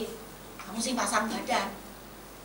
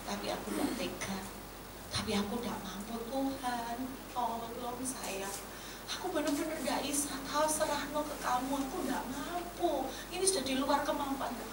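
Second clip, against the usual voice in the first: soft, helpless pleading; tearful prayer, acted; 2 semitones higher, 7 dB quieter than usual. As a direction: imitate a quiet, desperate plea to God. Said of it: "Berdoa dan menangis, teriak".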